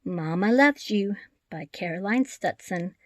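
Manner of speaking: ordinary delivery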